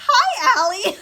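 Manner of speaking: high, loud greeting bursting with laughter; amused greeting. Retold or imitated. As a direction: sing out the hello, about to crack up. Retold greeting at the funny climax.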